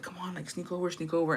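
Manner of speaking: soft, secretive urging; hushed coaxing. Retold or imitated. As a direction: imitate a boy coaxing her over quietly. Voicing boy secretly coaxing girl over.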